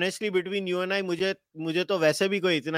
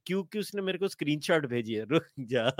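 usual speaking voice

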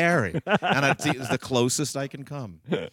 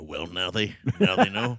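lightly voiced Johnny Carson, lower; little Johnny Carson; 5 semitones lower than usual. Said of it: "You just did little Johnny Carson there".

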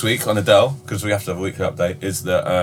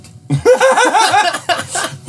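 loud squeal of laughter; a sudden high, loud laugh; real high laughter; high burst of laughter; 5 dB louder than usual. Real, not acted. Laughing at the sailors joke.

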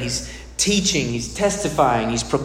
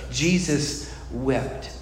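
slow, soft and grave, Jesus wept; hushed, slow reverent delivery of Jesus wept; slow solemn reading; 3 semitones lower, 5 dB quieter, much slower than usual. Shown as a demonstration. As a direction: let Jesus wept land slowly and softly. This short little verse.

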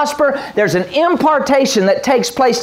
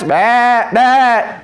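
mock sheep bleat; 3 semitones higher than usual. Preacher mocking sheep with a bleat.

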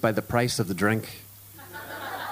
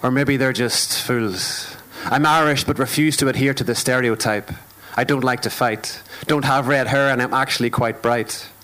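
steady rhythmic poetry performance; spoken word poem. Acted. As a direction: perform it like a stage poem, measured and deliberate.

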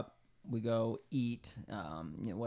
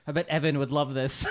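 loud, higher-pitched joke outburst; mock shouted quote; 4 semitones higher, 11 dB louder than usual. Joking impression of what was said.

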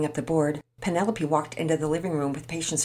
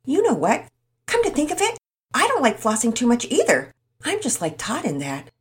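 sudden interjection; 8 semitones higher, 6 dB louder than usual. Acted. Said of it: "Tommy suddenly interjected".

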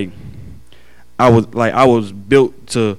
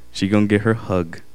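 usual speaking voice

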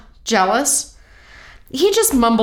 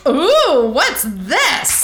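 surprised; 6 semitones higher, 4 dB louder, much slower than usual. Acted. Finds a hidden gadget.

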